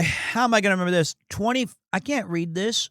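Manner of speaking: usual speaking voice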